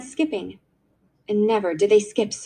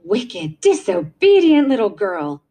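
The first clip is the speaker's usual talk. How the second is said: bitter rebuke, building to a louder second word; bitter scolding; 4 dB louder, slower than usual. A narrator's reading. Aunt rebuking her niece after the visitors flee, bitterly. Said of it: "said Aunt Beryl bitterly to Kezia".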